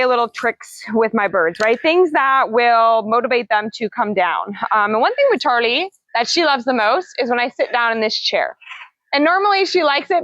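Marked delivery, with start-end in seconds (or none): none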